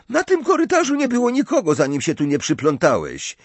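high-pitched, quick comeback; indignant retort. A narrator's reading. Ginny retorts to her intruding brother, high and indignant. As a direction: fire back hotly at your brother.

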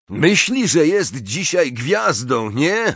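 high, drawn-out taunt; mocking. A narrator's reading. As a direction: needle a rival with sarcasm. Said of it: "rozległ się drwiący głos".

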